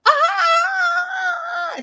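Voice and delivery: long high imitated shriek; loud, drawn-out hammed-up scream; mock scream. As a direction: keep screaming, comically overdone. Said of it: "still screaming".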